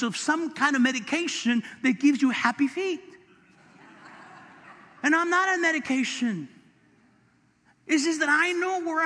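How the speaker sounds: high, loud sermon climax; fiery preaching. Acted. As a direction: preach it to the rafters. Preaching at full force.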